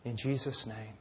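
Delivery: soft, low, earnest prayer voice; closing prayer. Acted. Pastor closing the service praying quietly to the Lord, soft earnest prayer. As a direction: pray humbly and quietly to the Father.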